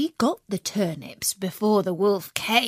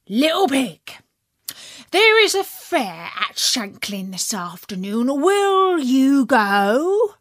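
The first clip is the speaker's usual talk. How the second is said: wolf voice; 5 semitones higher, 4 dB louder than usual. Acted. Wolf invites pig to fair.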